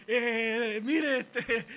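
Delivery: high, loud, faltering hail; acted calling after someone. Car keeps moving, calling after the driver loudly. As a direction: call out to stop the car.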